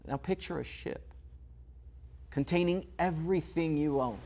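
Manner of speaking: normal talking voice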